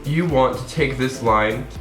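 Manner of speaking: ordinary delivery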